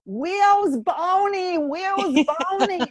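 put-on slow, high little girl saying Wheres Bawney with w for r; says w for r. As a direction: stretch out Where's Barney like a small child, r sounds turned into w. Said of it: "she couldn't say her R's".

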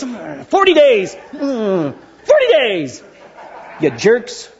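muttered, begrudging forty days; grudging Jonah. Retold or imitated. Grudging Jonah preaching to people he hates, slow and sneering. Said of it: "he probably was like this".

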